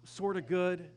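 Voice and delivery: gushing, loud and high compliments; butter him up, overdone; loud mock flattering; mock flattery. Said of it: "He doesn't say".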